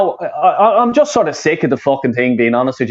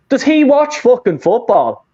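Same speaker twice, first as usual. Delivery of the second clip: slow, higher, scornful question; scornful disbelief; 7 semitones higher than usual. Real, not acted. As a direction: sneer at the tweeter's football knowledge. Mocking a fan's tweet.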